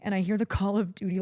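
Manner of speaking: usual speaking voice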